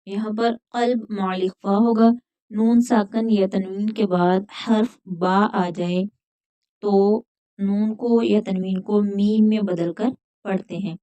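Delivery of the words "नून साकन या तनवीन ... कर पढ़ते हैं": ordinary talk, nothing marked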